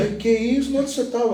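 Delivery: put-on a surprised kid asking where dad was; imitating his son. Father voicing his son's surprised reply, pitched high. Son replies in retold dialogue.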